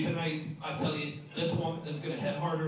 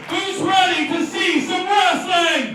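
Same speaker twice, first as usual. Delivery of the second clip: much higher, louder, slow hype yell; announcer yelling at the crowd for more noise; crowd shout; 15 dB louder, much slower than usual. Shown as a demonstration. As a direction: drag each word out to pump the crowd. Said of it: "No, I said".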